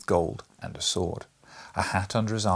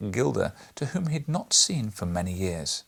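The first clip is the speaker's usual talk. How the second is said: ordinary delivery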